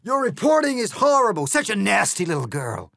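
very loud, very high shriek; outraged yell. Acted. The egg yells at Alice in outrage, very loud and high. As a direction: explode in outrage. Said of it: "he cried".

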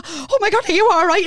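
high, startled "are you all right"; mock-panicked check on the lads; alarmed concern. Shocked after crashing into van.

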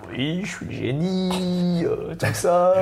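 slow, high put-on genie; lightly hammed genie, slow and high. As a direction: ham up the genie. Genie voiced in told story.

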